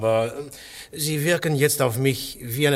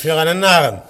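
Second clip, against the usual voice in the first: mocking jab; 4 semitones higher, 9 dB louder than usual. [name] mocking Gregory, finishing his sentence loudly. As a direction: cut in loudly with a scornful finish.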